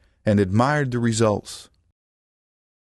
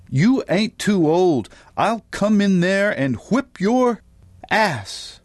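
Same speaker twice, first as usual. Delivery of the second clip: higher, drawn-out angry warning; scolding threat; 8 semitones higher than usual. A narrator's reading. Mama threatens to come into the bathroom, scolding. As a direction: threaten him in a high scolding voice.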